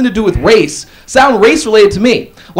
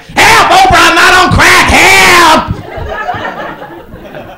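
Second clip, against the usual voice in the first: mock plea for help; 9 semitones higher than usual. Chappelle on Oprah's couch begging for help, mock panic. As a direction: beg Oprah in hammed-up panic. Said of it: "I wanna be like".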